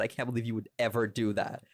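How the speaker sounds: ordinary talk, nothing marked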